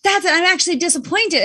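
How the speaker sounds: light up as the right word lands; retold realization. Friend hears the right word for her feeling, relieved recognition.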